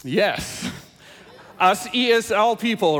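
ordinary talk, nothing marked

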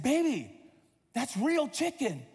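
mock dismay, stretching the words; alarmed father. Father alerting daughter to chicken nugget.

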